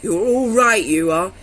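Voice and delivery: gruff approval. Retold or imitated. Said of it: "he said".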